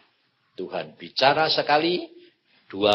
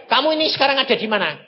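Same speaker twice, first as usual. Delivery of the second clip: high, fast, louder questioning voice; God's question; 10 semitones higher, 5 dB louder, much faster than usual. Retold or imitated. God questioning the sleepy preacher, high and fast. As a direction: quiz him quickly from above.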